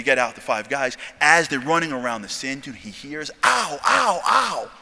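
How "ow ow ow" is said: high, repeated yelps of hurt; mock cry of pain. Injured guy limping round the dune, mock pained yelps. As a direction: yelp as if hurt, acted out.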